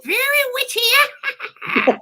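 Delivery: high, loud, drawn-out witchy voice breaking into a cackle; witch cackle. Pretend. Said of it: "It's my best witch laugh".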